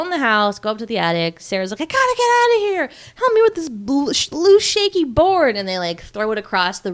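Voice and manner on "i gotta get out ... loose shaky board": high, loud mock panic; frantic. Girl trapped in the attic needing help, frantic. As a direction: act out a desperate escape plea.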